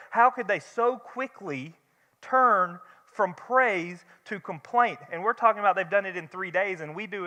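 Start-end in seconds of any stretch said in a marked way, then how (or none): 0.1-5.0 slow, high, put-on scandalized voice; mock self-righteous judge. Preacher mocking the congregation's quick judgment, slow and scandalized. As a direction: drawl it out like a smug churchgoer. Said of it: "So, so often we like to go".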